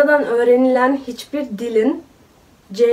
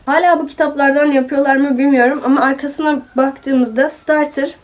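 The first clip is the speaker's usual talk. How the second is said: ordinary delivery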